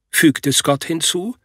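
usual speaking voice

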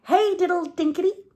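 high, loud squeaky mouse voice; high squeaky mice taunting the cat; mice answering. Acted. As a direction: chirp it like little mice. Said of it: "answered the little mice".

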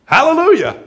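loud, high Hallelujah; a rider's eager Hallelujah; shouted hallelujah. Retold or imitated. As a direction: call it out to start the donkey. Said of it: "He sat down and said".

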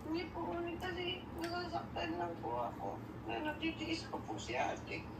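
hushed, hurt and near tears; tearful. Real, not acted. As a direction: confide it very softly through held-back tears. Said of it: "Huwag kang umiyak na umiyak". (naiiyak, pabulong sa hina)

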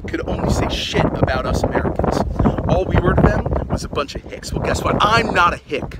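loud, angry protest; indignant rant. Acted. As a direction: push it out loud, taking offence. Indignant rant at being called hick.

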